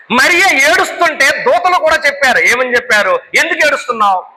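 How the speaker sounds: high-pitched urgent Bible retelling; impassioned preaching. Real, not acted. Preacher retelling angels questioning weeping Mary, high impassioned delivery.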